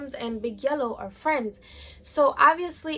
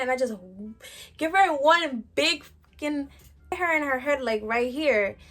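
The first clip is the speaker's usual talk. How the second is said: ordinary talk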